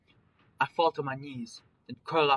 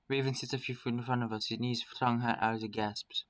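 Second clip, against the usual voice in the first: ordinary delivery, nothing marked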